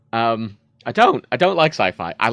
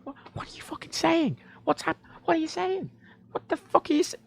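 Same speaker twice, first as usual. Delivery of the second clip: high, half-whispered bewildered demand; baffled demand; 4 dB quieter than usual. Retold or imitated. Player baffled by the game's voice, high half-whispered demand. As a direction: squeak out confusion.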